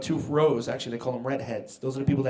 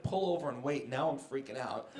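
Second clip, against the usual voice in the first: normal talking voice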